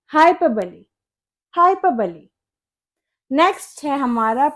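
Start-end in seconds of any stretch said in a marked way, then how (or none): 0.1-2.3 हाइपरबली as "hai-puh-buh-lee"; correct pronunciation. Shown as a demonstration. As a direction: finish हाइपरबली on "buh-lee", not "bole". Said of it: "इसी correct pronunciation होगी". (हाइपरबली को "हाइ-प-बली" बोलें)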